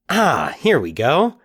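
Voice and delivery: slow, pleased exclamation; triumphant. A narrator's reading. Mike finding his yearbook, triumphantly pleased. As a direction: savour finding it. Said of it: "he said triumphantly".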